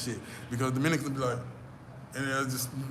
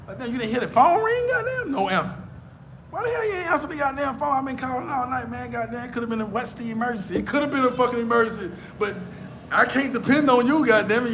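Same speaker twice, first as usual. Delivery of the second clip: higher-pitched, acted angry rant; exasperated complaint; 9 semitones higher, 3 dB louder than usual. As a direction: chew them out for not answering. Acting out an angry unanswered call.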